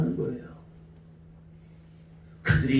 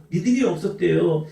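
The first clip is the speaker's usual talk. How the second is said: ordinary talk, nothing marked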